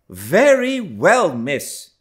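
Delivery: loud, slow feigned anger; mock angry. A narrator's reading. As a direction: pretend to be cross. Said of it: "concealing my joy under an angry countenance".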